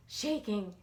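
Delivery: ordinary delivery, nothing marked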